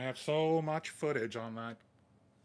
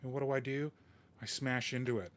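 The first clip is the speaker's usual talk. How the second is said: ordinary talk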